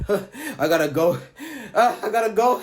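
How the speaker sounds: laughing through the words, voice going up. Real, not acted. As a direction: laugh while saying it. Laughing retelling of running.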